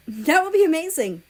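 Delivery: overjoyed exclamation; exclaiming. A narrator's reading. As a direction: burst out with joy. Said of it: "exclaimed Ukraine".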